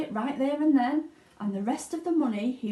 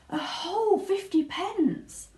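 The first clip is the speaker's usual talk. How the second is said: awed delight; 10 semitones higher, slower than usual. A narrator's reading. Charlie marvels at the coin.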